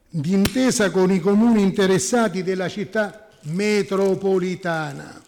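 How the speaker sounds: loud, deliberate reading of a legal text; reading aloud. Real, not acted. Councillor reads the article's clause to the meeting, loud formal reading. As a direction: read it out forcefully, phrase by phrase. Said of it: "io le leggo".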